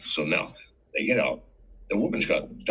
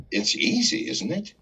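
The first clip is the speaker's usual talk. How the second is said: pointed reproach; 14 semitones higher than usual. Retold or imitated. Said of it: "I go".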